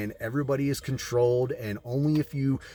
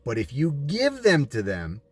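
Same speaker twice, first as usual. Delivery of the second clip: ordinary talk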